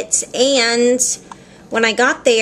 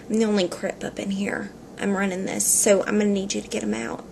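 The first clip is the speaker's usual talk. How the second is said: softly laying down the law; hushed, playful claim to be in charge; mock-bossy undertone; 8 dB quieter, faster than usual. Customer tells shop guy who runs this, quietly bossy.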